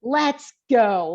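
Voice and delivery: emphatic rallying cry; confident energy. Retold or imitated. Trainer wanting clients to feel able, confident energy. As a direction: punch out "go" with confidence. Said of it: "like, that energy of confidence".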